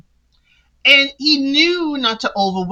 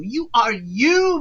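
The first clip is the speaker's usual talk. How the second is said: ordinary talk, nothing marked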